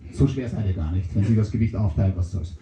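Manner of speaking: very low, dopey mushroom voice. Acted.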